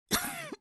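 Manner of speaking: a wet, weak cough; sick cough. Acted. Ailing healer to her lord, a sick cough. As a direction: cough like an ill woman.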